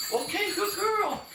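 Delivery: very high, drawn-out puppy praise; dog praise. Real, not acted. Trainer praising dog at the door, high happy praise.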